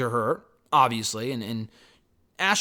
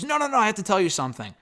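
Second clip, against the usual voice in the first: fast, higher-pitched imitated plea; urgent interruption; 6 semitones higher, faster than usual. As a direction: blurt it out, imitating someone desperate to confess. Acted urgent plea before match.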